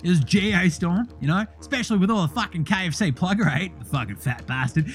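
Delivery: brash bogan voice throughout; Deli character voice. Acted. Host plays the character Deli.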